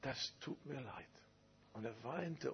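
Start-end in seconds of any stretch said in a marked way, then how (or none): 0.0-1.1 tearful apology. Retold or imitated. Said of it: "er fing an zu weinen".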